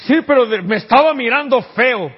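high, loud mocking whine of a complaining believer; mock whiny complaint. Preacher mocks Christians who complain, high loud whine. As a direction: whine it like a sulky church member.

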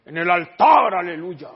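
loud burst of hammed-up piety; mock zealous cry. Preacher parodies a confession about the altar, mock zealous cry. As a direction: belt it out like an overzealous believer.

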